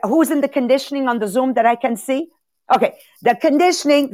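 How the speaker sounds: usual speaking voice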